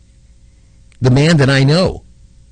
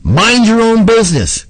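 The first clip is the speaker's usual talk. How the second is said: curt soldier; 10 semitones higher, 3 dB louder than usual. Retold or imitated. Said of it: "some guy turns around and says".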